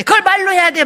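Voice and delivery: hammed-up snappy comeback; mock exasperation. Wife answering husband's caring question, in mock exasperation.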